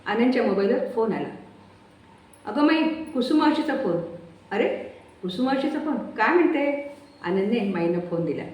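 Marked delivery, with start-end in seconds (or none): 2.5-6.9 much higher, slow announcing; surprised. Acted. Son hands Mai a call from Kusum, surprised.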